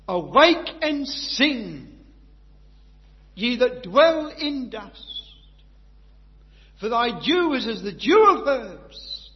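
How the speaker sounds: loud, drawn-out proclamation; slow proclaimed scripture. Acted. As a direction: call it out slowly over the congregation. Scripture quote proclaimed slowly.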